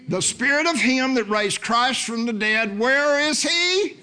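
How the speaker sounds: high, fervent preaching building to shouts; full-force preaching. Real, not acted. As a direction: preach it boldly, high and driving.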